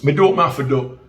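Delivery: light Jamaican accent, a mild imitation; a hint of Jamaican patois, calling his name. Quoting himself to the informant in a light Jamaican accent. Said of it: "Jamaica and them say [name]".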